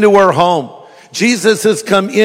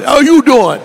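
louder, punchier New York mouse, YOU hit high; brash New York greeting, punch "you"; high, cocky New York swagger; New York accent; 7 semitones higher, 4 dB louder than usual. Acted. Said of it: "Like a New York mouse".

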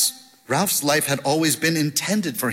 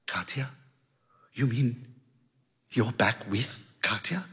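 quiet surprise; 4 semitones lower, 7 dB quieter than usual. A narrator's reading. Caller surprised by the news.

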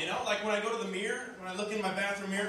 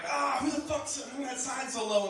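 pained yelp; 8 semitones higher than usual. Retold or imitated. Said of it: "And you're like".

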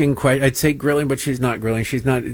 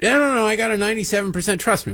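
high, rushed mock-student voice; pretend student; 7 semitones higher, much faster than usual. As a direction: insist in a hurried, higher-pitched kid's protest. Pretend student insisting to teacher.

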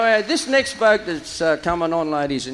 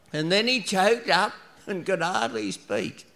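ordinary delivery

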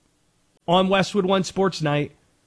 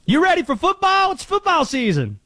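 high-pitched hype-man voice; [name]; 10 semitones higher than usual. Acted. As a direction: call it out high, then drop at the end. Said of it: "[name] here".

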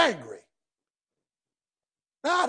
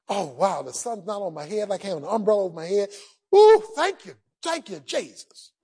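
blissful relief; 6 semitones lower than usual. Acted. Jonah enjoying the shade.

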